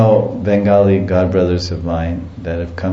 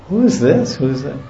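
put-on high, puzzled whispering between girls; bewildered, pitch jumps up on who; confused gopis asking each other; 7 semitones higher than usual.

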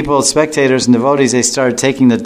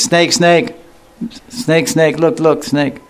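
put-on high, eager child shouting snake; a child excitedly pointing out the snake; excited kid calling; 6 semitones higher, slower than usual. Kid at the basket excitedly calling snake.

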